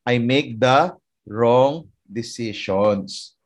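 each word drawn out slowly; deliberate, stretched emphasis; slow emphatic repeat. Shown as a demonstration. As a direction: let the words land one by one.

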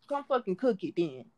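ordinary talk

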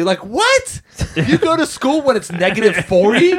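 high-pitched, incredulous outburst; real disbelief. Real, not acted. Reacting to schools open in extreme cold, astonished. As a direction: voice leaps up in astonishment.

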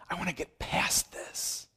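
unvoiced confessional whisper. Pastor voicing a sinner's inner thought, whispered.

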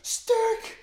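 high-pitched, loud chant yell. Retold or imitated. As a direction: belt it out like a crowd chant. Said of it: "I just yell".

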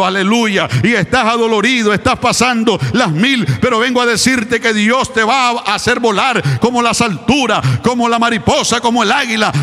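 high, loud, half-sung preacher's cry; soaring Pentecostal chant building to a peak; fervent preaching. Acted. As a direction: lift the room, sing-shouting the promise. Sermon climbs into fervent preaching.

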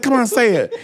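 mock coaxing fan; pleading fan. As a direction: urge him to say it, laughing. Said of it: "She's like".

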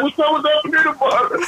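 calling out. Retold or imitated. Friends calling out on the street.

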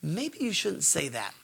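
very soft, slow inner voice; a tiny nudge from inside. Acted. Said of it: "little tiny thing inside of me that says".

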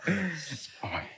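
quiet breathy chuckle; brief amused laugh; soft laugh. Real, not acted. Guest laughs at the echo.

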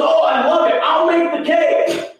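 very high delighted exclamation; thrilled wife. Retold or imitated. Wife jumps at the party idea, imitated high-pitched delight. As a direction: imitate a woman gushing with enthusiasm.

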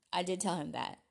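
normal talking voice

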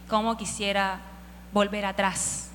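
quiet, wistful sigh of longing; soft wistful longing. Retold or imitated. David quietly wishing to go back, wistful. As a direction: sigh it softly, wishing for the past. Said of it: "Y él solo dice".

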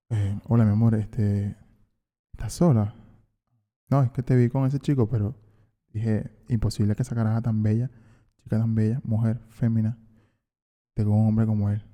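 low, soft pickup-line voice; smooth-talk her, quiet and low; seductive voice. Pretend. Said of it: "como que vos pondrías para seducir a una fémina".

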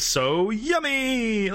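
put-on high, hugely drawn-out kid delivery; imitated game-dub kid. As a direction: stretch it out in mock childish delight. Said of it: "the delivery that kid did was, like, hilarious".

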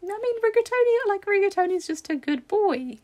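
put-on high, loud baby talk; dog-talk voice. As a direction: coo it like talking to a puppy. Cooing about the dog.

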